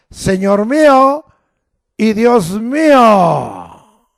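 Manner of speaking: loud, high, slow exclamation; preacher showing how to cry it out. Shown as a demonstration. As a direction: lift your voice and declare it. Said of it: "levantando tu voz".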